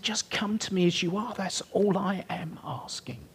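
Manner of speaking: soft high whispered plea; gentle whisper. Acted. God inviting the preacher to come as he is, whispered. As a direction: breathe it like a loving father. God voiced as tender father.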